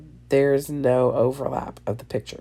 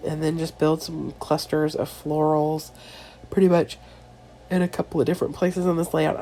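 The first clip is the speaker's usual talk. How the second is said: ordinary talk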